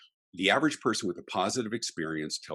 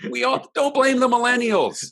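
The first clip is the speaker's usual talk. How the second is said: emphatic exclaim; 10 dB louder than usual. Real, not acted. Exclaiming to the host.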